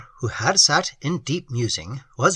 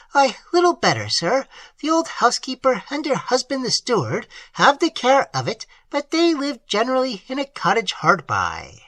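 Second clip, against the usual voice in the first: put-on higher, earnest voice for the old host; higher voice of a plain-spoken country host; old peasant host; 10 semitones higher than usual. A narrator's reading. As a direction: voice the simple host, eager to tell. Host describes the chateau.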